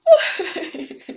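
big open loud yawn. Real, not acted. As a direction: let out a huge noisy yawn. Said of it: "Sorry for that weird yawn".

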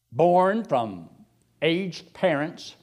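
normal talking voice

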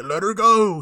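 hammed-up heroic demand; mock command. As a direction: bark it like a hero giving an order. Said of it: "Billy's like".

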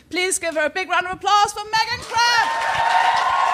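slow, drawn-out host call to the crowd; host announcement. Acted. Host calling the audience to applaud Megan, slow announcer call. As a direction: bring her on like a ringmaster.

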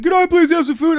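very high, loud pleading screech; hammed-up shrieked plea; homeless man impression. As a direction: mimic a frantic man begging. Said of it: "Did he sound like that?".

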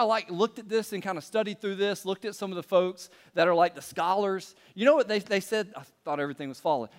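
normal talking voice

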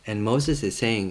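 usual speaking voice

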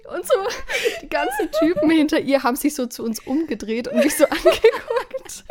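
words breaking into laughter; giggling through the story; laughing while telling. Real, not acted. Retelling funny club moment.

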